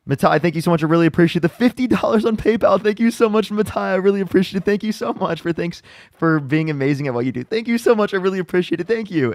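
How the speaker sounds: high, loud, gushing gratitude; excited thanks. Real, not acted. Streamer thanking a fifty-dollar donor, excited.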